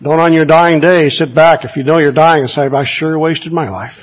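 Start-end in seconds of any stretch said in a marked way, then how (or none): none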